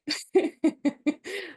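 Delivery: loud, high burst of laughter; big laugh. Real, not acted. Laughing at own naive plan.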